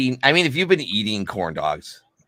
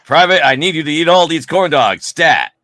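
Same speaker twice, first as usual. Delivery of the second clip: drill sergeant orders; 5 semitones higher, 7 dB louder than usual. Pretend.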